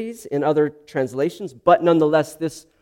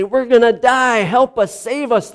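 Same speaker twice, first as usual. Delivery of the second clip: high-pitched, hurried cry for rescue, acted; panicked pleading; 7 semitones higher than usual. Disciples waking Jesus in the storm, acted panic. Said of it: "wake Jesus up and say".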